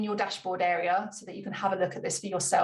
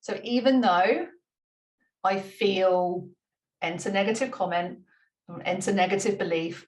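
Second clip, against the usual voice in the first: setup statement demo. Shown as a demonstration. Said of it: "normally it would look like this".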